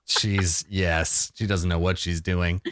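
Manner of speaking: lightly voiced dog, low and understated. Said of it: "the dog just, like, nods".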